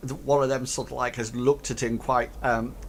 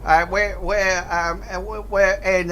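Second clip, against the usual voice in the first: nervous goblin; 5 semitones higher, 5 dB louder than usual. Acted. GM voicing nervous space goblin.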